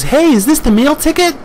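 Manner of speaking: high-pitched mock girl voice; girl's voice. One of the hotties asking, in a high mock girl voice. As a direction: imitate a girl in a squeaky-high falsetto. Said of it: "one of the girls says".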